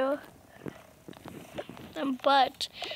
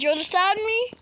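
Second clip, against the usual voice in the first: ordinary talk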